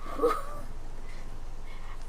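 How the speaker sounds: soft high rising "hoo"; wordless high squeal, lightly done; high-pitched whoop. Shown as a demonstration. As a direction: demonstrate a quick swoop up high. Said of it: "I do tend to go".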